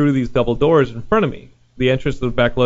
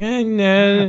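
high, weird shutdown; mock Tommy Wiseau; 7 semitones higher than usual. Tommy Wiseau shut down by the worker, high and weird. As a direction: mumble like Tommy. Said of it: "Tommy Wiseau was like".

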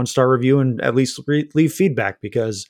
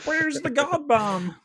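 much higher, slow cartoon alien voice; squeaky little Martian asking where it went; Marvin the Martian; 12 semitones higher, 6 dB quieter, slower than usual. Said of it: "a little bit of a Marvin Martian".

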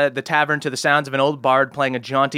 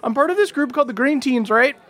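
put-on higher, stammering nervous young voice; nervous halfling boy; 11 semitones higher than usual. Halfling scoutmaster pleading with a hero, high and jittery. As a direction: blurt it out like a scared scout leader.

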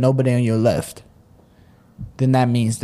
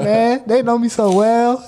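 mock gushing; 10 semitones higher, slower than usual. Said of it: "I love Panda Express".

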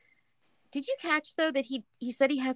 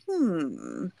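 pondering hmm; 8 semitones lower than usual. Pretend. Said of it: "Things that make you go".

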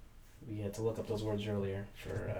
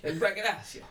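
mock Italian, higher and louder; Italian inflection; 8 semitones higher, 3 dB louder than usual. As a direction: imitate an Italian word, hammy. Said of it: "you were saying it with that inflection".

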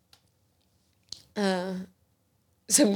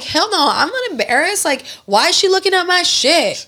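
high, loud indignant callout; 9 semitones higher, 3 dB louder than usual. Pretend. Telling off mom's nosy friend, high indignant callout. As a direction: snap back high and loud, pretending. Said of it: "I'd be like".

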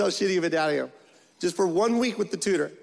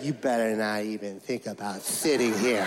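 put-on menacing bus kid; 11 semitones lower than usual. Kid refusing to share his seat, menacing low voice. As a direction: warn them off in a much lower voice. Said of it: "he's like".

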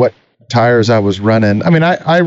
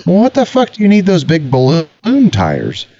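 disgusted dad; 6 semitones higher than usual. Retold or imitated. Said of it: "He goes".

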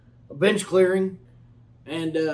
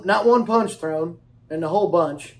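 normal talking voice

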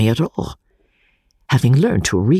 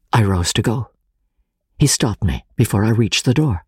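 ordinary delivery, nothing marked

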